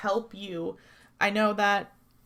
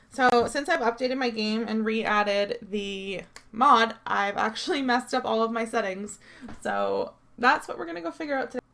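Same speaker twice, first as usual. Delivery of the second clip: ordinary talk, nothing marked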